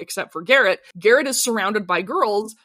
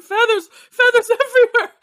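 high, louder mock panic; freaking out Countess; 12 semitones higher, 6 dB louder than usual. Recapping the Countess freaking out over feathers, high mock panic. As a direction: squeal it like a frazzled Countess. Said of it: "She starts freaking out and she's going".

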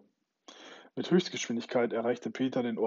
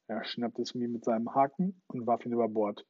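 ordinary delivery, nothing marked

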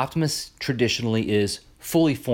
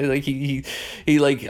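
ordinary talk, nothing marked

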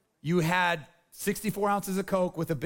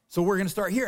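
ordinary delivery

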